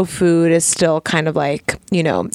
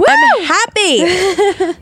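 high, slow, drawn-out I'm happy; sing-song; 13 semitones higher, much slower than usual. Shown as a demonstration. Repeats line hammed up.

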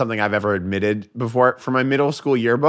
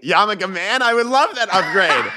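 laughing while talking, pitched up; giggling through the words; amused, laughing delivery; 14 semitones higher than usual. Real, not acted. Laughing about the nickname upgrade.

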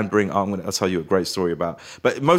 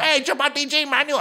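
very high mock fan doing Chabuddy G; dodgy Chabuddy G accent. Fans coming up to the actor, dodgy Chabuddy G accent. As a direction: call out in a badly faked Chabuddy G accent. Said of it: "doing quite dodgy Chibadiji accents".

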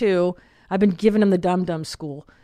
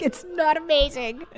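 exasperated objection; 8 semitones higher than usual. Retold or imitated. Mom objects to employee praising son's swearing, exasperated. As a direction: whine it back in disbelief.

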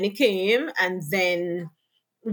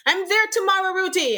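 aunt's eager announcement; 11 semitones higher than usual. Retold or imitated. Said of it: "she's like".

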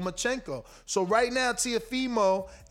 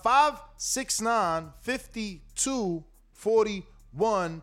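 digits read slowly, spaced out; slow and deliberate; much slower than usual. Shown as a demonstration. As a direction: spell out the number slowly. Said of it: "reading out call-in number".

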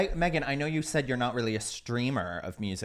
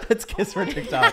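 high, laughing agreement; 8 semitones higher, 4 dB louder than usual. Real, not acted. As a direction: giggle it back.

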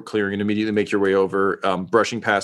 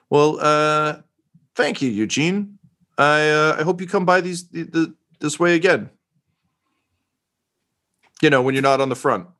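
higher-pitched, faltering reporter farewell; fond reporter; 8 semitones higher than usual. Acted. Reporter hoping the officer visits again, lightly acted and awkward. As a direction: fumble through a hopeful goodbye.

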